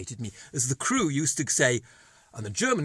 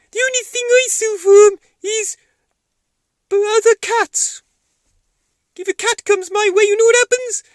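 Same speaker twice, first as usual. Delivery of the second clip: very high, loud squeaky cat voice; a gleeful little cat bragging; high cartoon cat with a mean streak; menacing puppet cat; 15 dB louder than usual.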